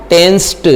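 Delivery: टेंस्ड ending on a crisp t sound. Shown as a demonstration. Teacher to students, modelling the ed ending: crisp t. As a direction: finish टेंस्ड with a sharp t. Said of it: "क्या बन गया?".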